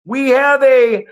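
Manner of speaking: high, loud mock announcement to the whole street; hammed-up shouted call-out, slowed at the start; imitating a scream-out. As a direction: call it out like a public alarm. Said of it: "I love watching him scream out".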